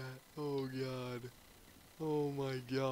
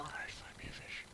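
whisper; 13 dB quieter than usual. Real, not acted.

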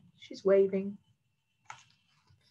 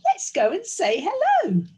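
high, loud, eager princess; Princess Pearl; 11 semitones higher, 6 dB louder than usual. Acted. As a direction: propose it in a high, bright-eyed princess voice. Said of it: "said Princess Pearl".

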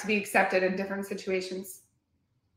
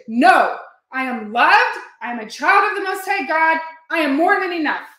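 louder and higher, overriding the doubt; declaring affirmation louder; 4 semitones higher, 5 dB louder than usual. Shown as a demonstration. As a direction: proclaim it with full conviction. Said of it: "you just say it over and over".

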